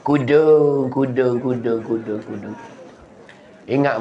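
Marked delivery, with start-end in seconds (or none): 0.1-2.6 fading echo. Acted. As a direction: imitate an echo dying away. Echoes the word, fading away.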